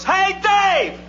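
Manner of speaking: high, long-held holler; distant bleacher shout. Acted. Fan yells from the bleachers, high distant shout. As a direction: call it out as if from far up in the stands. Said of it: "this voice from way up in the bleachers".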